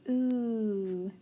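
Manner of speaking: "oo" held as one long vowel; sounding out. Shown as a demonstration. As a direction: draw out the vowel "oo". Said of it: "Let's sound that out".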